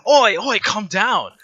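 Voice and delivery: loud, high "oi, calm down"; shouted warning. Acted. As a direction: shout it to stop him. NPC shouts at the shooting player.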